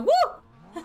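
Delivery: excited whoop. Real, not acted. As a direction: whoop with joy. Repeats the happy whoop.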